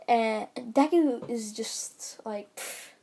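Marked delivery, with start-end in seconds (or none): none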